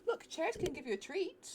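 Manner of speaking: ordinary talk, nothing marked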